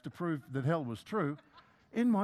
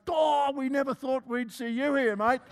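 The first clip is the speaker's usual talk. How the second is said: higher and louder, mock amazed; shocked welcome; 5 semitones higher, 5 dB louder than usual. Heaven's crowd greets the surprise arrival, mock shocked. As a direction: gasp it out as if seeing a ghost. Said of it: "turn around in shocked silence and say".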